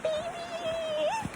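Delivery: put-on high cooing baby voice; baby talk to a pet. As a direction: coo it softly to a cat. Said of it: "I'll be like".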